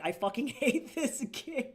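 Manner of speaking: hammed-up loathing, laugh breaking in; exaggerated hatred. Streamer quotes every Greek player online, exaggerated hatred. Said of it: "every Greek person was like".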